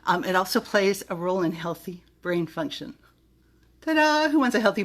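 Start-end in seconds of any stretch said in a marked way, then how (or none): 3.8-4.8 high, loud showy ta-da; ta-da flourish. Real, not acted. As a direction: present it like a magic trick. Announcing with flourish.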